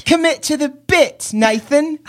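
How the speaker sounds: put-on high-pitched British kid scolding; British child critic. British child audience heckling the host, high scolding kid voice. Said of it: "British children".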